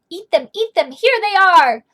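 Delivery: loud and insistent, pushing the plate forward; eager shout. Acted. Sam urges grumpy guy, loud eager offer. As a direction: call it out, shoving the food at him.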